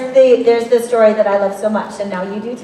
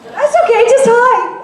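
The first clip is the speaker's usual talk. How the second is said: reassuring; 11 semitones higher, 3 dB louder than usual. Real, not acted. Reassuring nervous fan.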